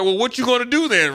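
squaring up to the coach, daring him; challenging. Retold or imitated. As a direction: call his bluff, high and heated.

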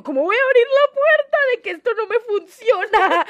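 high, loud, laughing alarm; half-laughing at her own horror; shocked disbelief. Retold or imitated. Retelling to friends how she saw her broken finger, in laughing disbelief.

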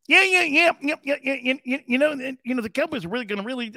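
high, squeaky rapid-fire chatter; high-pitched mock coach at the mic. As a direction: imitate a squeaky-voiced football coach, tripping over the yeahs. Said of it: "got a squeaky voice like that".

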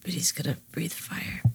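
hushed whisper. Real, not acted. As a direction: whisper it close to the mic. Player's quiet aside.

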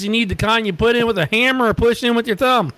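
half-deaf husband confused by the price check; deaf old man. Retold or imitated. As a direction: answer like a hard-of-hearing old guy who misheard. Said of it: "He says".

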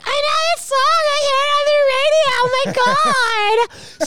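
very high squealing excited voice; excited fan. Retold or imitated. Mimicking casual fans at the gig, very high and excited. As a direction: squeal like a thrilled fan who knows the hit. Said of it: "people that don't particularly like prog, are like".

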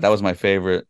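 normal talking voice

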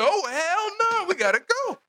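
high, alarmed and hurried to leave; mock panic. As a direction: bolt from the cursing robot. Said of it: "reacting in fear".

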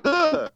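high-pitched, squealed shudder of pleasure; shivery shudder. Acted. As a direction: let out a high shiver, as if whispered to down the neck. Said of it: "you get that down your neck".

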